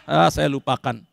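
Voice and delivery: ordinary talk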